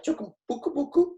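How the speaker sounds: high, quick little aside; playful shy aside. Pretend. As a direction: squeak it out fast and playfully. Playful aside illustrating being shy.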